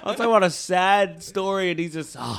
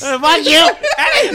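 high, very loud yell through laughter; mock outraged shout; 11 semitones higher, 12 dB louder than usual. Host cursing Eddie for not ending it, shouted.

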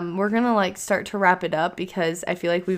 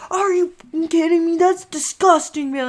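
much higher, louder mock outrage of a disgusted dad; dad grossed out; 10 semitones higher, 5 dB louder than usual. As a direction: throw it away in hammed-up disgust. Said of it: "He's like".

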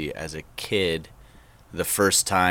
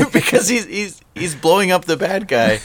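high-pitched, loud laughing explanation; 11 semitones higher, 5 dB louder than usual. Real, not acted. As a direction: talk through real laughter. Laughing while explaining the joke.

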